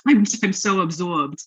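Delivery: put-on playful New York accent. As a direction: say it like a New Yorker.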